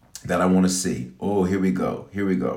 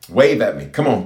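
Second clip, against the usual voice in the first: ordinary delivery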